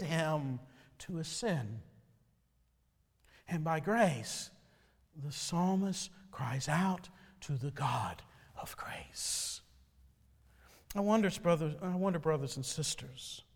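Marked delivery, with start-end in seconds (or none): 5.2-9.6 hushed whisper, barely any voice. Real, not acted. Preacher whispering to the congregation about grace, hushed. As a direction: drop to a breathy hush on grace.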